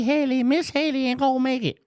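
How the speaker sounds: high, put-on sugary lady voice; mock sweet voice. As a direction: coo it like a fake-sweet old lady. Mock sweet voice about Haley.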